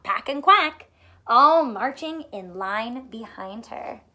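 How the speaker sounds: ordinary delivery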